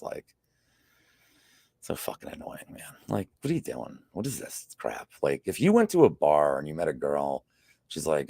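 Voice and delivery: ordinary talk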